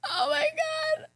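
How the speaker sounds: very high, slow squealing laugh. Real, not acted. Laughing at being kid zoned.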